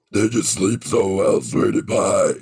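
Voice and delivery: low, menacing demon growl. Retold or imitated. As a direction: sound like a horror film demon. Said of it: "sounded like demon coming to murder somebody".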